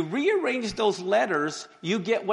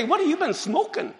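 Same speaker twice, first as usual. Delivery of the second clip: mocking retort; 8 semitones higher than usual.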